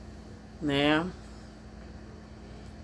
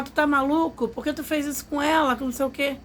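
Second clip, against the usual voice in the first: sister scolding; 7 semitones higher than usual. Retold or imitated. Sister confronting the husband.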